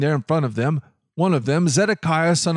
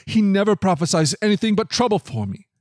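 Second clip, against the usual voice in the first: higher-pitched, exasperated complaint; exclaiming; 6 semitones higher than usual. A narrator's reading. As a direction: exclaim it as proof you were right. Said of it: "the king of Israel exclaimed".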